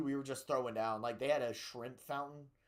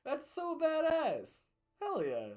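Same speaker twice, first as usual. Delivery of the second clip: excited; 14 semitones higher, 4 dB louder than usual. Real, not acted. Excited reaction to lunch story.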